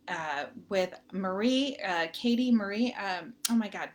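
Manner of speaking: usual speaking voice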